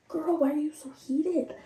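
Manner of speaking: high-pitched mock puzzlement; mock innocence. Petty partner asking angry girlfriend, in mock innocence. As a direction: feign innocence, voice lifted.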